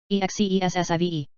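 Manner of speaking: letters rattled off fast; quick spelling. Shown as a demonstration. Teacher spelling the word fast for the class. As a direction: run through the letters briskly. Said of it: "spelling drill".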